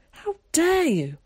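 high, slow, mock-outraged cry of How dare you; theatrically scandalised; cried out. A narrator's reading. Zack rescues the dropped book with mock outrage. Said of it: "cried Zack".

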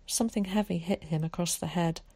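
plain reading voice

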